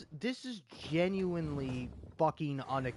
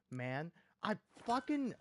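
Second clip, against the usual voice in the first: ordinary delivery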